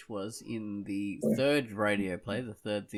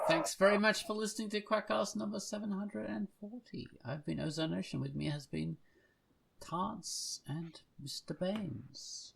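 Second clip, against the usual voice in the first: upbeat host outro voice, a touch higher; podcast sign-off; 8 semitones higher than usual. Acted. Host thanking listeners at the end of the show, in a bright outro voice. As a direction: wrap up the show like a radio host.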